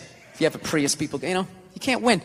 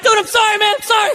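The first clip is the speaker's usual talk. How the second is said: high, loud, flustered apologizing; frantic apology; 12 semitones higher, 4 dB louder than usual. Retold or imitated. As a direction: apologize in a panic to a stranger. Apologizing after dog lunged.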